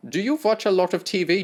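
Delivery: put-on German-accented doctor, scornful. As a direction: sneer at the boy in German accent.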